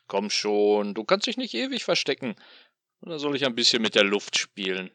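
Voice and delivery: loud, sneering demon voice; gloating tormentor taunting his captive; mocking demon. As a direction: toy with him like a cruel god.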